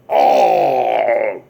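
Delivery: long mock groan of impalement; pained cry. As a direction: let out a drawn-out acted "oh". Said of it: "And he's like".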